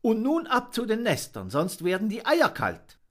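high, bustling send-off; motherly dove. Acted. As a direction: shoo everyone home kindly. Dove sends birds home.

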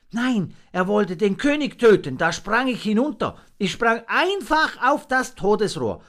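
put-on high, loud, breathless young monkey; frightened little monkey. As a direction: blurt it out, still shaking. Monkey Fips tells his fright.

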